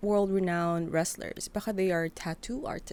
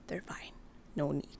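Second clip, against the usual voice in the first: whispered; 5 semitones lower, 9 dB quieter than usual. Acted. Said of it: "hands go down".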